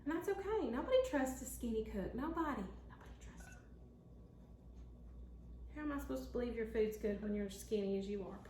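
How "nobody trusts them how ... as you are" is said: fast conspiratorial whisper. Real, not acted. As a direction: lean in and whisper the joke. Whispered joke about skinny cooks.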